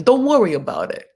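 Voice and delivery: light mock Italian accent, breezy. Host voicing a proposal dodge, mock Italian accent. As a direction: toss it off like a lightly voiced Italian. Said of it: "it's like Italian".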